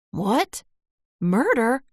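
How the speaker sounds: startled, questioning exclamation; crying out. A narrator's reading. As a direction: cry out in disbelief. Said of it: "she cried out".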